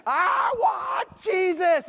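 very high, long-drawn tantrum howl; imitated screaming. Dad showing how the son's demand sounded, screamed imitation. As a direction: wail every word like a screaming child. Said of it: "is what it sounds like".

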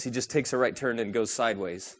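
normal talking voice

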